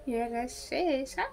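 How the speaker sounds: slow hushed sneer; muttered taunt. Acted. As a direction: mutter it under your breath. Teasing the cheater.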